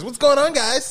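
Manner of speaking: high loud party bro greeting. Retold or imitated. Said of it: "he's that party bro voice".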